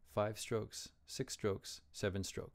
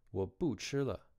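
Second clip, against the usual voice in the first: ordinary talk, nothing marked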